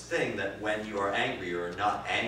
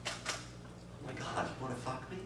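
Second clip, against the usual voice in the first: mock seductive; 4 semitones higher, 10 dB quieter, slower than usual. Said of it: "I'm gonna go".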